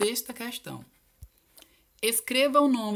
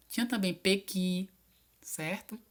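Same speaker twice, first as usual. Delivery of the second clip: ordinary delivery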